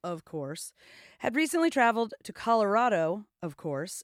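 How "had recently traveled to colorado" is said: projected newsreader delivery; announcer-like, emphatic story read; news read. Real, not acted. Reads a news item.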